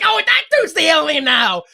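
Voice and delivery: hammed-up alarm; mock mob accusation. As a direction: imitate a panicky crowd pointing blame. Acts out mob accusing neighbour.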